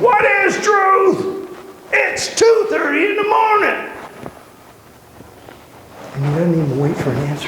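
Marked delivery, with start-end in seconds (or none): 0.0-3.8 screaming Pilate. Retold or imitated. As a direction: scream it like a man dragged out of bed. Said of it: "and you scream".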